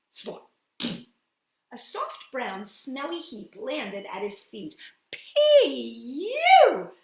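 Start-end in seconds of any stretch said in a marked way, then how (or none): 5.1-6.9 high, loud yuck; disgusted shout. Acted. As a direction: cry out at the stink. Mole smells the heap.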